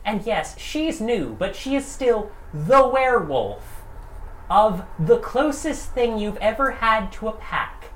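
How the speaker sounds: building to a shout. A narrator's reading. GM building to outburst.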